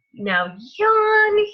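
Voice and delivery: loud stretched yawn; big yawn. Acted. Reading yawning truck.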